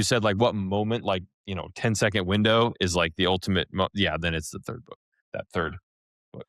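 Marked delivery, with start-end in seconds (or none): none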